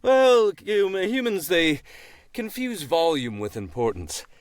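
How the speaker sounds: a dry, knowing crow voice; wise crow voice. Acted. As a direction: play a smug old crow. Crow character in skit.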